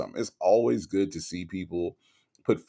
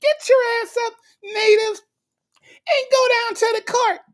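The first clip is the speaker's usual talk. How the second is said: mock high squeaky bossy voice, much higher and fast; imitating Vila North; 3 dB louder than usual. Friend Vila telling her to get up for court, high mock-bossy voice. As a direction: play the pushy friend barking orders in falsetto. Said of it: "and that's how she said it".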